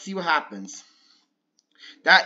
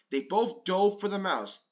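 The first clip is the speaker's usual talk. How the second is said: plain reading voice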